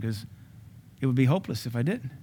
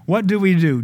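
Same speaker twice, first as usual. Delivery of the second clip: louder, raised question; rhetorical shout; 5 semitones higher, 5 dB louder than usual. Real, not acted. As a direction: press the question on the church. Preacher repeats question.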